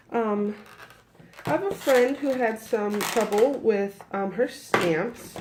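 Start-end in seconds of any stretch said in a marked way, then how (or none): none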